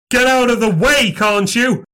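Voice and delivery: high, urgent narrated shout. A narrator's reading. Narrator to the figure blocking his path, shouting.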